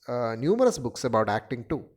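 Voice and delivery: usual speaking voice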